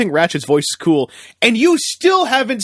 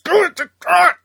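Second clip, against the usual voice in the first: ordinary delivery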